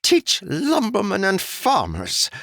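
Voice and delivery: high, slow, sneering nobleman's growl; growled scorn. Acted. Noble scorning the lumbermen, slow high contemptuous growl. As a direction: spit the words out with contempt. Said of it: "the noble growled".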